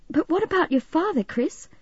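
high, worried question; anxious. A narrator's reading. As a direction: ask as if dreading the answer. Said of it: "she asked anxiously".

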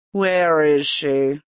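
slow, cold nobleman's voice; menacing duke. A narrator's reading. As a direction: interrogate her coolly. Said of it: "Duke Nixlas questions Erica".

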